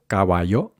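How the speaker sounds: Caballo with unaspirated k; no puff after the C in Caballo; muted k, rising Caballo; Spanish k. Shown as a demonstration. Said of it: "Then the k sound".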